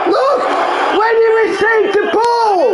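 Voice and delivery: high furious yell; angry shout. Real, not acted. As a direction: shout it at the screen. Player raging at replay.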